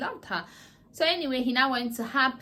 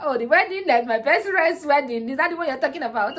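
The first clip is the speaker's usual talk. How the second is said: sarcastic retort; 5 semitones higher, 9 dB louder than usual. Retold or imitated. Sarcastic retort about best friend's wedding.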